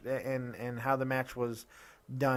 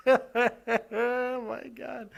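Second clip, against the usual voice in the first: high, drawn-out words through laughter; laughing exclamation; 9 semitones higher, much slower than usual. Real, not acted. Podcaster recovering from his laughing fit, laughing exclamation. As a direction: gasp it out while laughing.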